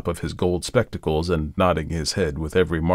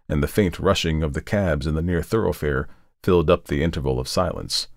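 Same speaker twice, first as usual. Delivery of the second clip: ordinary delivery, nothing marked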